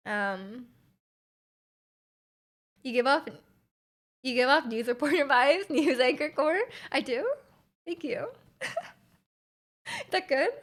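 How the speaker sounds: ordinary talk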